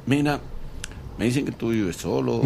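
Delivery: sly hushed request. Retold or imitated. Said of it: "Él te dice".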